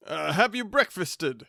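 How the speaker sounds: flustered, high, loud stammer; polite stammer. Acted. Flustered bachelor greeting his unwanted cousin with a stammer. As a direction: stumble over the greeting, flustered. Minns inquiring.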